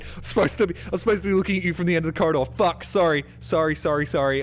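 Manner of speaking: loud, high mock scare turning to frantic apology; late jump-scare monster. Acting a late jump-scare man.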